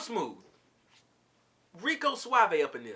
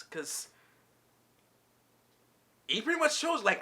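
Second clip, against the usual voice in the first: ordinary talk, nothing marked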